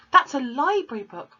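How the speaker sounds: loud, high urgent cry; cried out. A narrator's reading. Matilda crying out to her father about the library book, loud and high. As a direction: call it out in alarm. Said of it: "Matilda cried".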